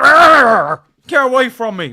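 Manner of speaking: loud growl then snapped line; dog Win growling. Retold or imitated. Streamer voicing the dog that scratched Pep, loud growl. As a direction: snarl like an angry dog. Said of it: "Win was like".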